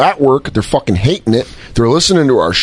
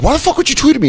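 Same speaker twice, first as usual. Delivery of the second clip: indignant retort; 11 semitones higher, much faster than usual. Retold or imitated. Retold confronting the fan.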